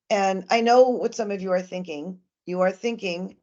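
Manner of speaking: usual speaking voice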